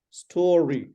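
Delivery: STORY with a long, drawn-out first vowel; correct pronunciation. Shown as a demonstration. Teacher repeats the word for student, careful pronunciation. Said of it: "It's not story".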